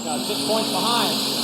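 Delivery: slow, loud punch line; loud emphasis. Real, not acted. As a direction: drive the number home. Story climax to crowd.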